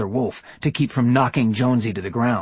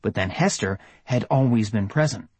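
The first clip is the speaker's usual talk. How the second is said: usual speaking voice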